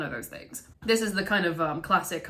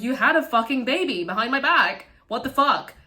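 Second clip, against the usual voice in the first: upset outburst; 6 semitones higher, 6 dB louder than usual. Retold or imitated. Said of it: "super upset by this".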